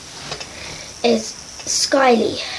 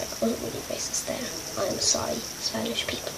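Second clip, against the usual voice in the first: whisper; 12 dB quieter than usual. Real, not acted. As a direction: whisper a sheepish sorry. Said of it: "whispered apology".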